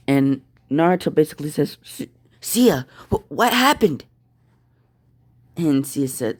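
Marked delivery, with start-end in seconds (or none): 1.8-4.0 high, stammering alarm; shocked stammer. Acted. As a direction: stumble over the words in shock. Naruto finds beaten Sia.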